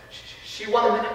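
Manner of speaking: faint, halting false start; embarrassed stammer. Acted. Frankie struggles to tell the priest, stammering. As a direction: stumble before the forbidden word. Child hesitates over rude words.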